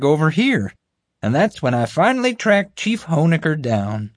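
high, eager, self-important voice; bumbling guard. Acted. Guard recounting the chase to the group, eagerly. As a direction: pitch it up and boast through the story.

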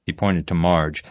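plain reading voice

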